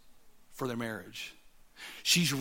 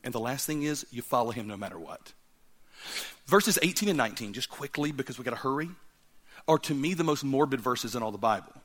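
ordinary delivery

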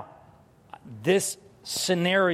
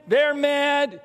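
ordinary talk, nothing marked

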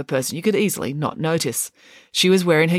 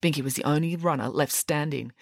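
ordinary delivery, nothing marked

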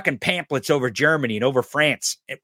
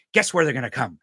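usual speaking voice

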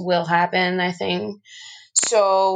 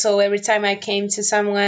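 ordinary talk

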